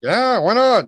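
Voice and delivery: high, breezy shrug; carefree. Retold or imitated. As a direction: toss it off happily. Repeats carefree reply.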